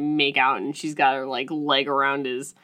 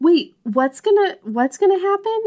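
mock naive; 12 semitones higher, 3 dB louder than usual. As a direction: play dumb and innocent, rising. Mocking heroine's naive question.

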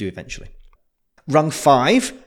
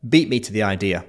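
ordinary talk, nothing marked

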